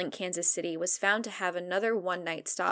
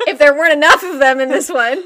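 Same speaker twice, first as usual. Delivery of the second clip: laughing; 8 semitones higher, 15 dB louder than usual. Real, not acted. Laughing joke about aliens.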